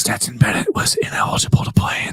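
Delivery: hushed, hammed-up stage whisper; mock secret whisper. Host adding another kitchen secret, mock whisper. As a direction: whisper it like a scandal being leaked. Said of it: "things that people are whispering".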